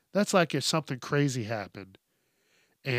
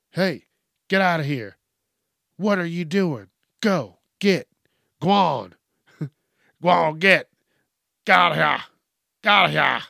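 loud, higher shooing yell; 4 semitones higher, 11 dB louder than usual. Acted. Boat owner chasing a sea lion off the boat, yelling. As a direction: bellow to drive an animal away. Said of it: "would you yell at it".

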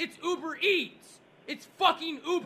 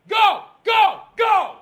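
loud, high barked orders; yelling; 6 semitones higher, 9 dB louder, slower than usual. Acted. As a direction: shout them away, word by word. Yelling someone off the table.

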